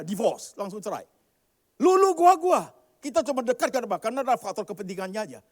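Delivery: loud, high fervent preaching; preaching at full force. Acted. Pastor loudly warning couples in the congregation. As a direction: drive the warning home.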